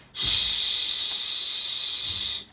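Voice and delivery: loud unvoiced shushing; room-wide shhh. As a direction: shush a noisy kid. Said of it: "says the whole room".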